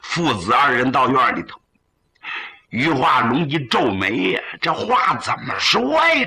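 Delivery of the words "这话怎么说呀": a higher, thrown-up cry of perplexity; old father caught off guard, asking how to say it; puzzled outburst. Acted. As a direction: blurt it out, baffled.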